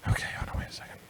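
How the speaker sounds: hushed, breathy whisper. Real, not acted. Host pausing the show, speaking in a real whisper. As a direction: drop to a whisper so nobody hears.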